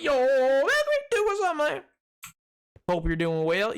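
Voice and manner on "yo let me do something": high, mock-eager voice. Streamer mimics someone jumping in, in a high squeak. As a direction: squeak it out like an excited kid. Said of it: "Sorry, like".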